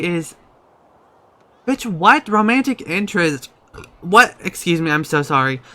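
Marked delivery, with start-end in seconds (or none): 1.7-4.3 high, loud what in outrage; stunned disbelief. Real, not acted. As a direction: react as if betrayed by the news. Shocked by game romance notice.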